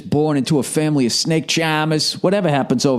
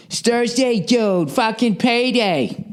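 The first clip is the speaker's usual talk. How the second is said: put-on bro voice, slower on payday; bro coworker voice; 8 semitones higher, slower than usual. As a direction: gloat about payday like a dude at work. Acted payday exchange with coworker.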